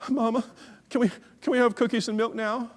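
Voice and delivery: put-on high, small child's voice; imitated child's tentative, hopeful request; hopeful little boy. Boy hopefully asks mother.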